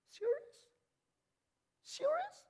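very high, rising one-word question; incredulous squeak. Acted. Preacher challenging proud churchgoers, high incredulous squeak. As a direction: squeak it upward in mock disbelief. Mock disbelief at proud worshippers.

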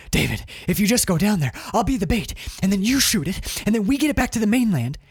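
earnest urging. Retold or imitated. Mark urging David to let him be bait, pleading. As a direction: talk him into the plan, pitch lifted.

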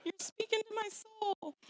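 high, laughing gush. Retold or imitated. Mother laughing at the toddler definition, high excited gush. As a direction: squeal along, delighted and amused. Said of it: "I'm like".

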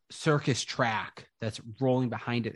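ordinary talk, nothing marked

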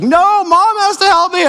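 high, whiny imitation of a small daughter; upset Addie. Dad retelling daughter turning down his help, high mock whine. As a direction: mimic a crying child refusing help. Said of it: "And she's like".

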